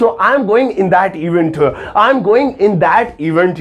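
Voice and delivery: very slow, careful model of the right sentence. Shown as a demonstration. Teacher modelling the correct event sentence, very slow. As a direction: say it slowly so students can copy.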